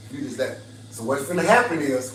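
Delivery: ordinary talk, nothing marked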